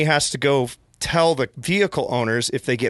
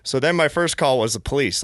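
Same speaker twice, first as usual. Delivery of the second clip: normal talking voice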